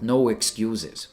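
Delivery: normal talking voice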